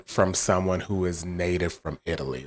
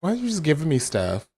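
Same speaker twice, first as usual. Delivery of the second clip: surprised; 10 semitones higher than usual. Real, not acted. Khan suddenly offers him payment.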